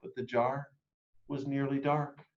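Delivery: plain reading voice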